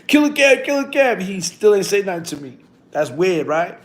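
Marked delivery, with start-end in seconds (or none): 0.1-1.2 repeated hype chant, high and loud; hyping someone up. Retold or imitated. Imitating commenters cheering the speaker on, hyped. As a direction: cheer the name like a crowd egging him on. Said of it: "they hyping my shit".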